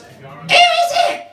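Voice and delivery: very high, slow squawking parrot. Acted. Parrot squawking at the knocker. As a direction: screech it out like a parrot.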